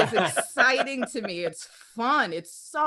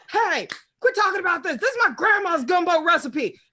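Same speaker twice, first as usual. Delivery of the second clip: higher and louder, offended; indignant online poster; 6 semitones higher, 4 dB louder than usual. Retold or imitated. Gumbo poster snapping at critics, indignant and loud. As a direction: defend grandma's gumbo, outraged. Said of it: "People would be like".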